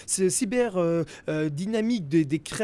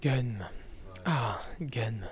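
low breathy whispered purr; sultry narrator; 11 semitones lower, 8 dB quieter, much slower than usual. Pretend. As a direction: whisper the title like a seduction. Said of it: "je prends ma voix suave". (voix suave chuchotée)

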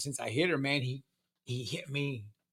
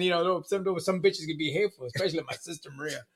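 ordinary delivery

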